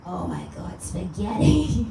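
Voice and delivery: raspy, slow Joan Rivers attempt that fizzles; half-hearted Joan Rivers drawl on the food word; a bad, slowed Joan Rivers try; Joan Rivers impression. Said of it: "Joan Rivers will talk about it".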